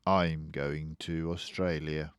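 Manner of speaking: quiet resolve. Acted. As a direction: say it softly, half to yourself. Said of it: "I said".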